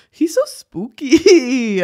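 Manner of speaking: high, drawn-out squeal; giddy gushing. Real, not acted. Fan gushing over [name]'s dark lyrics, high and drawn out. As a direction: squeal it, stretching the last word.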